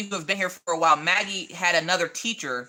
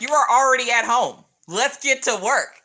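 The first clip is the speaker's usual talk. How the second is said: loud, higher mock teacher order; 6 semitones higher, 6 dB louder than usual. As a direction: imitate a teacher pushing the kids to work. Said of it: "the teachers are like".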